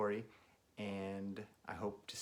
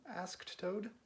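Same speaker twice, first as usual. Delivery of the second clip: ordinary delivery, nothing marked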